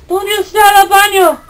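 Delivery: high squeaky Spanish voice; bad guy speaking Spanish. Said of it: "He said Spanish!".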